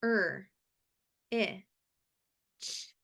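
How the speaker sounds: "Er. I. Ch." one separate sound at a time; sounding out words. Shown as a demonstration. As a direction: sound out "Er. I. Ch." slowly with pauses. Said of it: "Tap each dot and say the sound".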